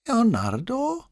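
put-on high, questioning echo from an old man; feigned puzzlement in an old man's voice; puzzled old man. A narrator's reading. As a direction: repeat the name as if never heard, pitched high.

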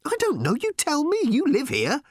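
high-pitched, impatient retorts; exasperated retort. A narrator's reading. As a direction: throw it back, pitch jumping up. Frantic argument over escaping the planet.

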